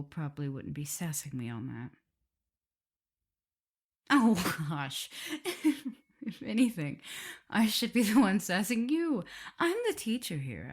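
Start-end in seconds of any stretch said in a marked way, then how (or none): 4.1-5.2 laughing. Real, not acted. Laughing at listener's teasing. 6.2-10.7 playful sass. Acted.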